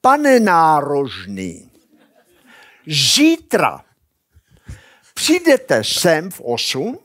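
slow, loud, spelled-out words; hearing teacher speaking slowly; slow clear speech. Retold or imitated. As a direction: articulate each word slowly for the hard of hearing. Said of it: "posadí takhle proti sobě a řekne".

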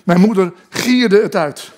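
ordinary talk, nothing marked

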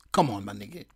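usual speaking voice

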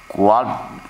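ordinary talk

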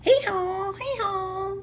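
high braying hee-haw; donkey hee-haw. Pretend. Teacher reading to kids, high playful hee-haw. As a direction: bray like a silly donkey. Donkey sound in picture book.

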